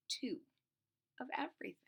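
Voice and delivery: soft unvoiced ending; whisper. Real, not acted. Storyteller whispering question to children. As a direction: let it fall to a whisper. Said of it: "teller whispers question".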